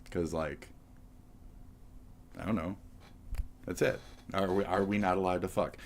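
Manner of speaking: ordinary talk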